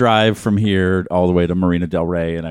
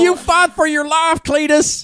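much higher, slow put-on voice; mock character voice; slower than usual. Mocking the anti-abortion film, high silly voice. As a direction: ham it up in a silly squeaky voice.

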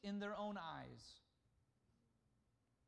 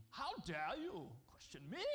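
mock medieval king; 2 semitones lower than usual. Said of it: "medieval king ... ready to backhand you".

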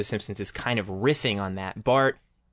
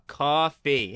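"coffee" in two drawn-out, separated syllables; patient over-enunciation of "coffee", slightly higher; retold correction; 4 semitones higher than usual. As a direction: spell out "coffee" syllable by syllable, raised pitch. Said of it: "And she goes".

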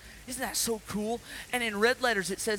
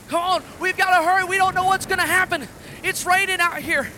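loud urgent shouts over the storm; shouting fisherman; 9 semitones higher, 14 dB louder than usual. Acted. Fisherman yelling to his crew in the storm, loud and urgent. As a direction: bellow orders to the crew.

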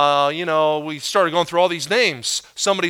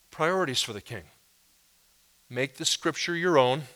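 normal talking voice

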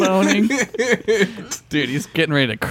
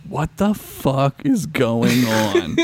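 slow, word-by-word mock disbelief; acted slow-motion confusion; drawn-out bafflement; much slower than usual. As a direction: stretch out the baffled question. Acting crew baffled by computer.

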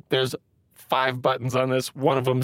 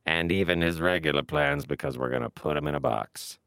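robot warrior voice; 5 semitones lower, 3 dB quieter than usual. Acted. Robotic warrior character speaking.

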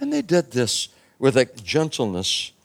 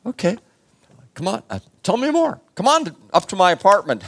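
much higher eager voice, speeding up; eager invitation; 8 semitones higher, faster than usual. Acted. Jailer inviting Paul and Silas home, eager. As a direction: grab them and pull them home.

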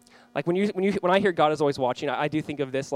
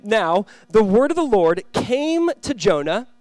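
slow, raised, solemn Bible reading; scripture reading; 4 semitones higher, 5 dB louder, slower than usual. Acted. Pastor reading Jonah to the congregation, slow and solemn. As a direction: read it out to the church with weight.

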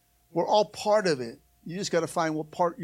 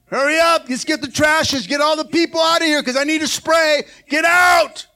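mock bossy shout; 7 semitones higher, 8 dB louder than usual. Bossy volunteer rushing people out.

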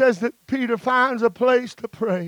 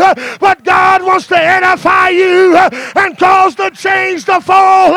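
loud, higher preacher's cry, building; rising preaching shout, louder at the close; full-force preaching; 9 semitones higher, 12 dB louder than usual. Real, not acted. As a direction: preach it out with fire to the congregation.

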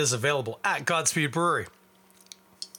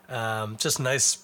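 normal talking voice

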